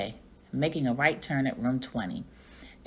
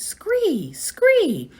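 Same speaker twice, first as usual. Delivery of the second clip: high, loud screeching cry; frog alarm screech; 13 semitones higher, 8 dB louder than usual. Acted. Og calls out in alarm, piercing high screech. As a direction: screech the alarm call twice. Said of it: "It was Og's alarm call".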